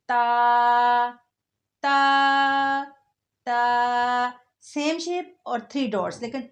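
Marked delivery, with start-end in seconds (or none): 0.1-4.4 "ता" said loud and clear, a steady held tone; clear letter drill. Shown as a demonstration. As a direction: drill "ता" like a teacher. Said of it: "ऊपर टू dots हैं". ("ता" साफ़ और ऊँची आवाज़ में)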